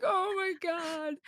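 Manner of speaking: very high, slow drawn-out wail; retold dismay. Driver backing out of the wrong place, mock-distressed wail.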